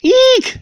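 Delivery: a sharp high-pitched "Eek" scream. A narrator's reading. Woman in the bookstore sees a mouse, high frightened scream. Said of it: "she screamed".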